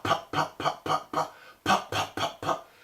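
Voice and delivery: breathy unvoiced pops into a palm; hand over mouth. Shown as a demonstration. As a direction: puff each pop out onto your hand. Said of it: "put your hand over your mouth and say pop".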